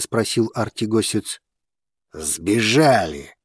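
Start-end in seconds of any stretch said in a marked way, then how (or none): none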